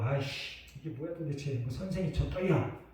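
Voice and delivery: soft, resentful muttering under the breath; muttered grumble. Retold or imitated. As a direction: grumble quietly, wronged. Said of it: "되게 억울했어요".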